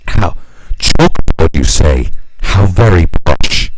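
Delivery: loud, slow, mock-posh exclamation; hammed-up snooty aristocrat. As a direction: sneer at the chalkboard menus like a toff. Mocking upmarket pub.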